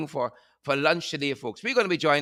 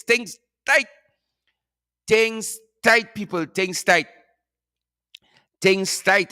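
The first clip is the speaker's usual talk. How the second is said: emphatic refrain; 5 semitones higher, slower than usual. Real, not acted. Host to diaspora viewers, emphatic pleading refrain. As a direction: hammer home that times are hard.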